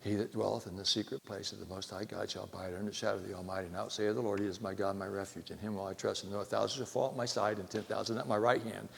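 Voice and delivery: soft, steady scripture reading; psalm recitation. Acted. Preacher reciting Psalm 91 to the congregation, soft and steady.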